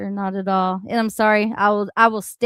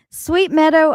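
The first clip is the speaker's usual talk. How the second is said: ordinary delivery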